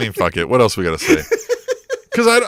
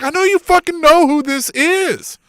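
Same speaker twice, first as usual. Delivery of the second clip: drawn-out high scoff; slow, high, mocking retort; 11 semitones higher, slower than usual. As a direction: stretch it out high like a disbelieving scoff. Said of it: "I'm like".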